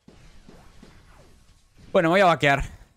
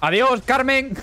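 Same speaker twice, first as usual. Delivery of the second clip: high, playful goodbye; mock farewell; 7 semitones higher, slower than usual. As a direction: wave them off cheekily. Farewell joke to killed enemy. (despedida burlona y aguda)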